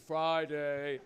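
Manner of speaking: low, drawn-out mock group answer; crowd answering together. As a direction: imitate a crowd droning in unison. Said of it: "everybody said it like".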